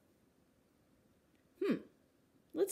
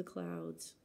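whisper; 8 dB quieter than usual. Acted. Wind whisper ends the line.

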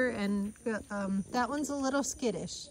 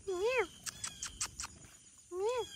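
high, soft coaxing call; high soft pet call; 14 semitones higher, 7 dB quieter than usual. Real, not acted. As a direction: coax the kitten in gently, up high. Calling kittens.

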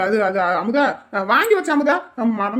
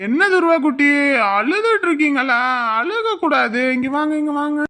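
put-on high, doting baby-talk; cooing to a child; 4 semitones higher than usual. Grandfather comforting his crying grandchild, high and cooing. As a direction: coax a crying toddler to come over.

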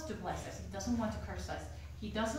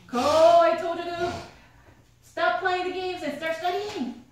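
much louder, higher furious mom yell; mother yelling upstairs at her gaming son; angry yell; 8 semitones higher, 11 dB louder than usual. Retold or imitated.

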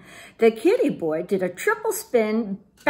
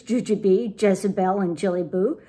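ordinary delivery

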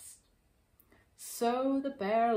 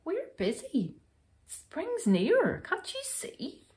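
high-pitched hare voice; high, brisk brush-off; squeaky little storybook hares; busy hares; 11 semitones higher than usual. A narrator's reading.

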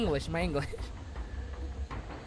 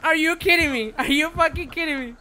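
usual speaking voice

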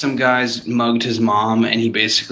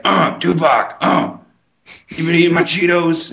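higher, grunting cartoon voice; put-on cartoon tough guy; 5 semitones higher than usual. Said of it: "And he's just like".

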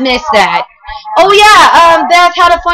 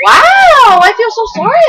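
mock-shocked wow; 7 semitones higher than usual. Real, not acted. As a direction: overdo the surprise on purpose. Mock sympathy reacting to story.

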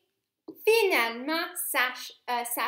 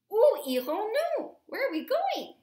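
eager; 7 semitones higher, 5 dB louder than usual. A narrator's reading. Sacha eager question.

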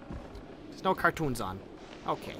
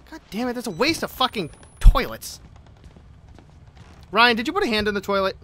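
normal talking voice

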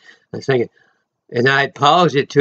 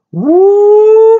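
high, loud joyful whoop; a sudden high shout of praise; ecstatic woo; 8 dB louder than usual. Real, not acted. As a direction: cry out in holy joy.

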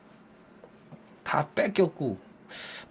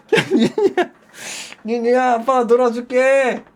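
high, loud pleading coax; coaxing dad; 4 semitones higher, 4 dB louder than usual. Retold or imitated. Dad pleading with daughter to play, high coaxing call. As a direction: beg the kid to come play.

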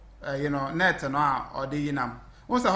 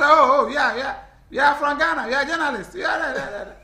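high, stammering we are, laughing through it; laughing retold quote; 8 semitones higher, 6 dB louder, faster than usual. Retelling how he told officials they were Ghana journalists, high and laughing. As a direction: replay your flustered self, giggling and tripping over we are. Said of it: "Like I say".

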